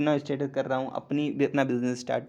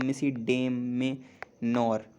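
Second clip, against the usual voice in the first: "मिसिडेमेनर" stretched even slower, every syllable clear; slow careful demo; much slower than usual. Shown as a demonstration. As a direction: say "मिसिडेमेनर" slowly, stressing मिसी. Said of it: "मिसी बोल रहा हूँ".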